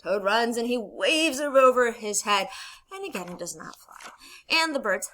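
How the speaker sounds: normal talking voice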